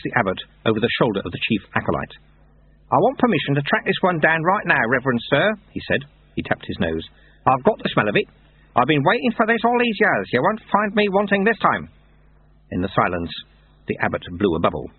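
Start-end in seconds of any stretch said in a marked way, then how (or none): 2.9-5.5 higher, urgent character voice; determined Lutze. Acted. Lutze asking the abbot for permission, determined. 7.5-11.9 high, eager character voice; determined Lutze. Acted. Lutze pressing the abbot to send him, determined.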